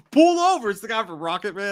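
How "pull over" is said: high, loud command; mock cop shout. Streamer quoting the cop pulling them over, loud. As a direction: bark it like a cop. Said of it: "He's like".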